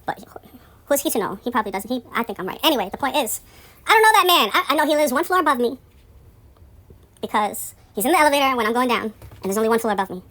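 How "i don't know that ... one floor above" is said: higher, louder insistence; indignant denial. Real, not acted. Denying the building rumour, loud indignant insistence. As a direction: stamp down the rumour.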